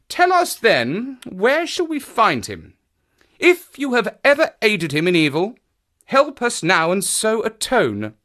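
high, firm character voice; pressing detective. Acted. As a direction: press the witness earnestly. Voices Holmes questioning her.

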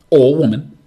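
"Or woman." blurted loud and bright; a quick jokey afterthought, punched out; loud aside. Real, not acted. Host correcting himself for listeners, loud playful aside.

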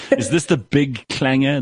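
laughing through the words, slow; half-laughing disbelief at a thousand pages; laughing question. Real, not acted. Host laughing at the huge report while asking.

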